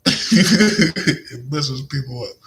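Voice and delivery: slow words through high laughter; laughing while talking. Real, not acted. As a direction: laugh it out as you speak.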